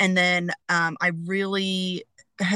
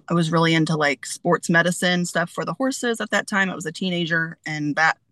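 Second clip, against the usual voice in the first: usual speaking voice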